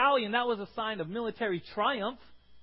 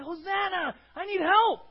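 loud, higher desperate shout; crying for help; 8 semitones higher, 4 dB louder than usual. Retold or imitated. Preacher acting out a plea to God, loud cry. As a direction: beg for rescue at full voice. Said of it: "it was a cry".

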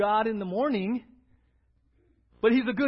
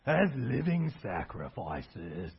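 slow, heavy, overly solemn scripture reading; 3 semitones lower, 6 dB louder, slower than usual. Shown as a demonstration. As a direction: read it like a grave pronouncement. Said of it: "we hear it like that, and we get very serious".

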